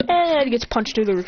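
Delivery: ordinary delivery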